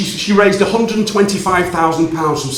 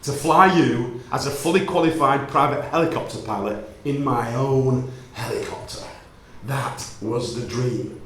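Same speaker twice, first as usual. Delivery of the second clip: tender confession; 4 semitones lower, 5 dB quieter than usual. Retold or imitated.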